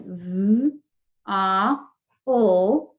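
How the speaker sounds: v, a, ul as separate drawn-out sounds; slow phonics sounding-out of v, a, ul; sounding out phonemes. Shown as a demonstration. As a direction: say each sound of v, a, ul alone, with a pause. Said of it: "if we sound that out".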